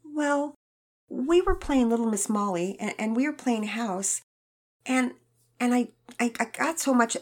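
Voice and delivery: put-on a worried girl confessing; stammering girl.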